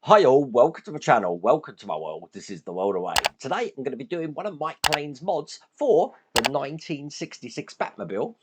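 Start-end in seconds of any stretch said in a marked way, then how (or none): none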